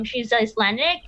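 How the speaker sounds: ordinary delivery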